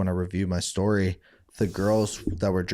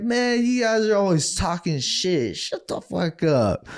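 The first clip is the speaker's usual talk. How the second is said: slow, drawn-out cocky retort; mock drunk snap back; 9 semitones higher, slower than usual. Drunk teen telling his friends off, slow cocky drawl. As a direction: talk back like a tipsy big shot.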